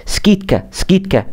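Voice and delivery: سكيتكا said high with a crisp t; model pronunciation. Shown as a demonstration. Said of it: "يبقى تنطق ايه".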